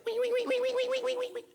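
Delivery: very high, rapid "wee" squeals; piglet squealing. Acted. Storyteller imitating a suckling pig, squealing. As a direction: squeal like a running baby pig. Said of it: "the pig goes like this".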